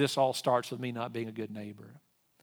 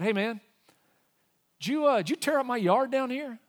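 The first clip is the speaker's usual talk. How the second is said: confronting; 10 semitones higher, 4 dB louder than usual. Retold or imitated. Confronting neighbor about torn yard.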